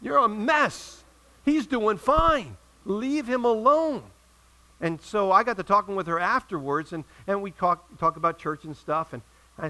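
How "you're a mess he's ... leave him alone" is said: high, drawn-out mock wail of exasperation; hammed-up indignant outburst, very slow; exasperated protest. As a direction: stretch each phrase high, pleading to back off. Acted exasperated inner protest.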